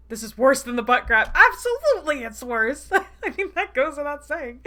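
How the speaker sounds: loud, high exclamation breaking into laughter; laughing outrage. Real, not acted. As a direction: protest loudly, then crack up. Reader reacting, laughing at the scene.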